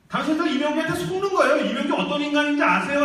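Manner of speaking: imagined woman exposing the preacher; accusing woman. Retold or imitated. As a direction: denounce him to the crowd. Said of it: "라면서 이 아줌마가".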